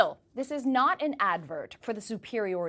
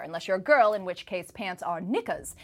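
normal talking voice

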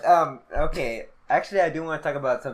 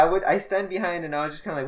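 normal talking voice

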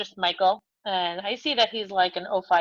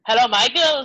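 put-on dad's hearty Spanish-accented greeting, higher and louder; 5 semitones higher, 7 dB louder than usual. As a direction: call out hello in a Spanish accent. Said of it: "so he has a great accent".

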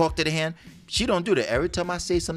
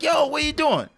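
startled mock shout; 13 semitones higher, 4 dB louder than usual. Startled at wife coming in.

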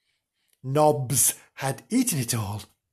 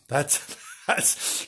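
ordinary delivery, nothing marked

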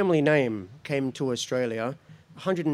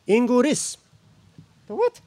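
baffled officer; 10 semitones higher, 5 dB louder than usual. Acted.

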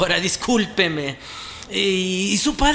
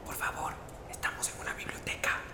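susurrando para pedir silencio; susurro; 2 semitones lower, 17 dB quieter, faster than usual. Acted. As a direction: pide silencio en voz muy baja. Piden silencio en la biblioteca.

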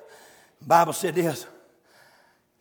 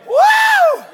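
high loud whoop; preacher whoop; 11 dB louder than usual. Real, not acted. Preacher whooping at the congregation, high and loud. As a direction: let out an ecstatic woo.

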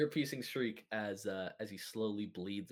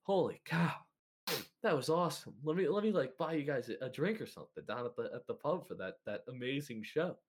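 higher, excited, acted breathlessness; out of breath; 5 semitones higher than usual. Uncle Fun runs up to the victors, out of breath. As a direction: gush as if just run up. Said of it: "while out of breath".

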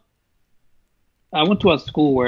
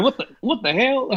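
shocked; 10 semitones higher than usual. Retold or imitated. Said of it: "I'm like".

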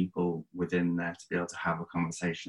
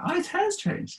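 mock-surprised aside in a much higher voice; surprised thought. Host recalling seeing the guest's new hair, surprised high pitch. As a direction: jump way up in pitch as if startled by a new look.

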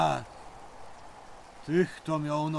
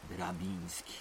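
ominous hush; 9 semitones lower, 11 dB quieter than usual. Acted. Ominous intro of scary man.

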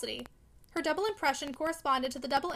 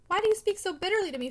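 higher and louder hurt question; hurt outcry; 6 semitones higher, 5 dB louder than usual. Acted. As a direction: cry out, stung by his words. Maggie hurt by Philip's words.

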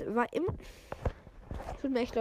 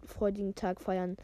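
usual speaking voice

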